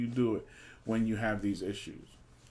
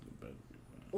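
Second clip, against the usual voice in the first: mock threat; 10 semitones lower, 13 dB quieter, much faster than usual. Teasing mock threat to co-host.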